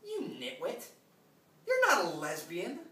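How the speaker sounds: high, loud burst of disbelief at yourself; building from a high rebuke to a loud cry; acted self-scolding. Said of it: "And you say".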